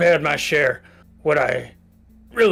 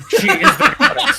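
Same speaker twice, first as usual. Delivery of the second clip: much higher, laughing through it; 6 dB louder than usual. Real, not acted. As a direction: crack up while saying it. Player jokes laughing.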